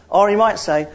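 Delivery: normal talking voice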